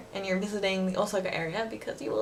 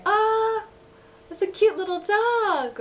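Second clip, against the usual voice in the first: cute gush; 12 semitones higher, 4 dB louder, slower than usual. Shown as a demonstration. Cute exclamation example.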